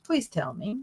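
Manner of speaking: normal talking voice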